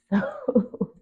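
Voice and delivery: bursts into a high, loud laugh on the word; laughing at her own joke to God. Real, not acted. Laughs after joking to God.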